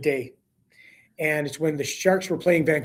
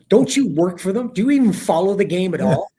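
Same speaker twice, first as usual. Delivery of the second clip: louder, retold indignant grilling; exasperated rising voice; 3 semitones higher, 6 dB louder than usual. Irate colleague grilling team broadcaster on the plane, exasperated. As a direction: imitate a colleague getting worked up in disbelief. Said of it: "his voice is going up".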